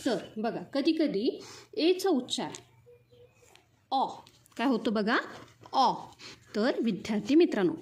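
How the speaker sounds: ordinary delivery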